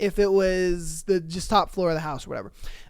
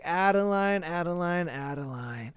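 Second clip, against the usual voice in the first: creepy taunting call; 4 semitones lower, 5 dB quieter than usual. Retold or imitated. Stalker taunting Adeline by the door, creepy fading call. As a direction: lure her out by name, fading away. Stalker calls her name, retold.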